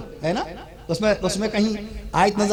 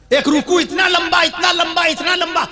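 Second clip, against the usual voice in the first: raised, high preacher's call; preaching at high pitch; 9 semitones higher than usual. Real, not acted. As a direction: declaim it high to the congregation.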